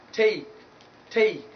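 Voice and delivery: "take" with gliding ay; diphthong ay. Shown as a demonstration. Teacher modelling the word, diphthong ay. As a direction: let "take" glide from e to i. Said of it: "not take".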